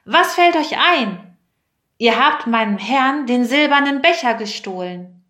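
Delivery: high, forceful reprimand in a storyteller's voice; stern shout. A narrator's reading. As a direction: call out sternly, accusing thieves. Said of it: "rief er streng".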